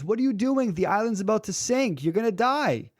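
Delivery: high-pitched, urgent pilot's warning; acted exasperated rescuer, voice jumping up; alarmed warning. Helicopter pilot warns stranded man, alarmed.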